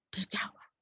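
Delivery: breathy whisper of awe. Real, not acted. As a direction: hush it, in awe. Whispered testimony. (bisikan penuh kagum)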